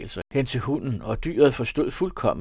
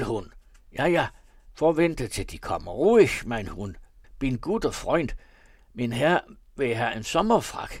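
German dialect; 4 semitones higher, 6 dB louder than usual. A narrator's reading. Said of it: "med sin tyske dialekt".

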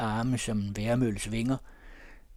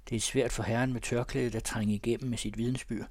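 usual speaking voice

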